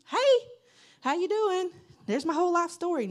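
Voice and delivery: high, loud, chirpy stranger greeting, rushing the last line; overfriendly stranger. Retold or imitated. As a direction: gush at someone you just met. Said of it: "If some strange person come up to you".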